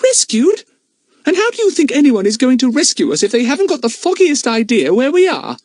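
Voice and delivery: put-on high, loud girl's challenge; a girl demanding an answer from her brother, high and loud. A narrator's reading. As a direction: throw the question back at him, high and indignant. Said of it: "demanded Dinah".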